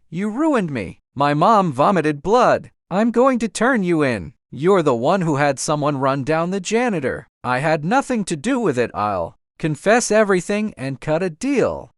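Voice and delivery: plain reading voice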